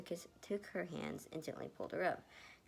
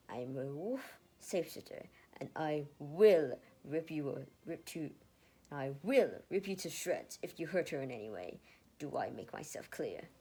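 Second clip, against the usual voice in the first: snapping threat; 7 dB louder than usual. Acted. Ned threatening Lucas to protect Erica, snarling. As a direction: growl the threat, deadly serious. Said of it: "Ned snapped".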